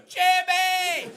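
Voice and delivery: high, drawn-out cry of a name; mock scream. Cockney girl crying out to her carbonized friend, high mock scream. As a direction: scream the name out high, stretched for comic effect. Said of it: "She screams out".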